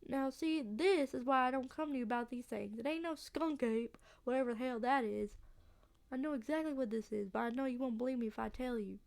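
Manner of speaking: exasperated Southern country voice; southern accent. Acted. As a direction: grumble emphatically in a Southern accent. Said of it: "a southern accent".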